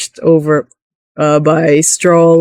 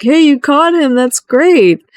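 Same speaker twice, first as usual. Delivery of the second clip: cheery guard captain; 8 semitones higher than usual. Retold or imitated. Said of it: "guard captain is like".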